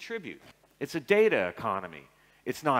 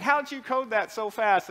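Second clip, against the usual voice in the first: high, louder amazed boss; mock boss voice; 8 semitones higher, 4 dB louder than usual. Boss marvels at his speed, high mock voice. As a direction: play the impressed boss a bit high. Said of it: "He's like".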